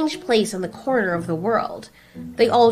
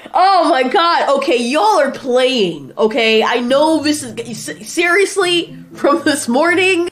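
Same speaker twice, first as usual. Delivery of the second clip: exasperated disbelief; 8 semitones higher, 6 dB louder than usual. Acted. Godzilla thinks his friends are playing, exasperated disbelief. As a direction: refuse to believe it, pitch rising. Outburst at being told to use tongue.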